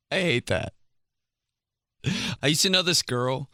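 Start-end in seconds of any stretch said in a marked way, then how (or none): none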